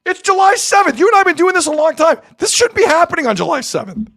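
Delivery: high-pitched incredulous rant; worked-up exclaiming. Real, not acted. Host to co-host on crazy July day, high excited rant.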